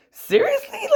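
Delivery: very high, disbelieving squeak; can't believe what you're hearing; stunned seriously; incredulous. Real, not acted. Disbelief at accusation.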